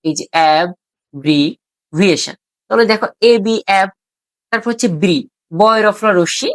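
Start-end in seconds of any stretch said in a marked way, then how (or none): 0.3-2.3 "অ্যাব্রিভিয়েশন" slowly in three parts, ab, bri, viation; lower, slow "অ্যাব্রিভিয়েশন" with a pause after each syllable chunk; split into syllables. Shown as a demonstration. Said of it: "তাহলে প্রথমে অ্যাব".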